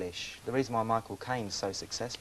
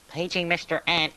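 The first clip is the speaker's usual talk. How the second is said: higher, louder mock tannoy call; airport paging call; 8 semitones higher, 6 dB louder than usual. Guest imitating airline staff paging him, mock tannoy voice. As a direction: page him like an airline announcer. Airport announcement of his name.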